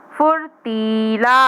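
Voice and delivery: फुर्तीला drawn out slowly, syllable by syllable; slow sounding out. Shown as a demonstration. Teacher to young pupils, saying फुर्तीला slowly. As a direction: stretch फुर्तीला long for the children. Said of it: "teacher sounds out word".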